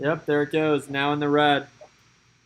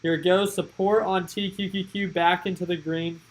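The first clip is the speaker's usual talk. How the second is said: ordinary talk, nothing marked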